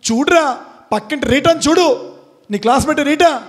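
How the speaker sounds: lightly acted, high-pitched scolding dad; scolding father. Father comparing son to classmate Rita, mock scolding.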